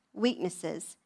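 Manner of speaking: ordinary delivery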